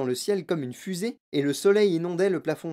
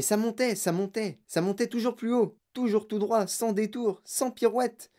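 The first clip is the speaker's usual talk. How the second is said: rising excitement; 6 semitones higher than usual. A narrator's reading. Narrator builds the rocket-like climb.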